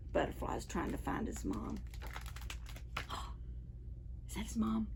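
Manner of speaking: quick hushed whisper; whispered aside. Real, not acted. Storyteller asking the children, whispered.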